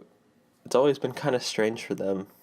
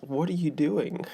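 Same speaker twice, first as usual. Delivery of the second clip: baffled; 6 semitones higher than usual. Retold or imitated. Baffled reaction to greetings.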